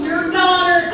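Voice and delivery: a hint of a dramatic mom; mom impression. Mom pronouncing her child doomed, lightly voiced. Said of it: "my mom talks like that too".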